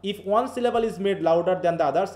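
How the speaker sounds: ordinary talk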